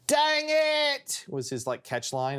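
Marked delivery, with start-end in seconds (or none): 0.1-1.2 put-on high British-accented yelp of Dang it; British accent yelled as a kid character. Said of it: "I'd use a British accent".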